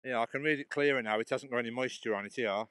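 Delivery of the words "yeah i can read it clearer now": ordinary talk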